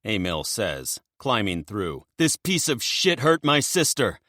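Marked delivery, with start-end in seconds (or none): 2.2-4.2 angry brother. Acted. Emil bursting in, enraged brother.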